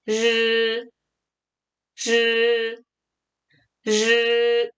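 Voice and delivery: 르 held level as a buzzy curled r; retroflex ri. Shown as a demonstration. Teacher models the sound, long retroflex 르. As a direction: curl the tongue back and sustain 르. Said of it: "이렇게 읽어주실 수 있어야".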